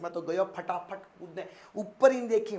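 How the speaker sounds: ordinary talk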